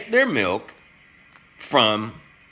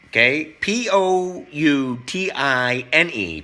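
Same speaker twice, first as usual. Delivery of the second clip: normal talking voice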